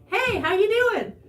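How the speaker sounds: high, loud, chipper hello; loud singsong how-are-you; breezy greeting. Retold or imitated. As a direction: imitate an oblivious cheery greeting. Husband's oblivious greeting retold.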